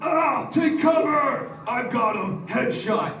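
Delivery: high, loud soldier yelling; grizzled soldier shouts. Retold or imitated. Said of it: "hard, grizzled men going".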